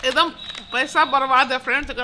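speaking with food in mouth; words between chews; chewing while talking. Real, not acted. Seen 3: cheek bulges and chewing lip movements between words after eating snack.

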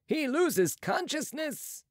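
high, slow exclamation; Marat's alarm. A narrator's reading. Marat sees the patient fainting, high drawn-out alarm. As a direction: pitch it well up, drawing it out.

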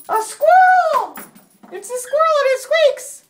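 very high, loud singsong squeal; excited reveal. Real, not acted. Mommy revealing River's squirrel toy, excited pet talk. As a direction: gush over a toy for a pet.

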